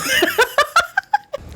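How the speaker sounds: high burst of laughter. Real, not acted. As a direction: laugh high and loose at own joke. Laughs after own joke.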